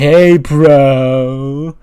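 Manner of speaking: loud laid-back bro voice. Retold or imitated. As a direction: greet like a chill surfer bro. Riddle walks in, bro voice.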